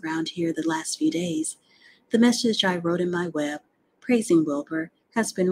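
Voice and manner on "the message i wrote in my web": usual speaking voice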